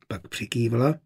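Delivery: plain reading voice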